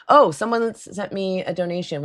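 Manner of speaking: ordinary talk, nothing marked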